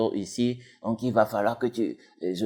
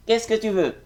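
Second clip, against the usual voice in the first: much higher put-on voice, a bit louder; second character voice; 11 semitones higher, 3 dB louder than usual. Shown as a demonstration. Same line for another character, much higher voice. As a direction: switch to a second character, pitch it way up. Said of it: "c'est toi encore qui va dire".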